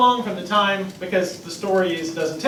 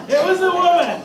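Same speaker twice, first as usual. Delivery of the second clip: laughing blame; 10 semitones higher, 5 dB louder than usual. Retold or imitated. Adam blaming, laughing.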